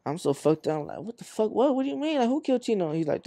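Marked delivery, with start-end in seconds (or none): 1.0-2.9 higher-pitched, disbelieving questions; retold shock. Hearing from brother that Chino was killed, retold shocked disbelief. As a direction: relive the shock, pitch jumping up. Reacting to news of killing.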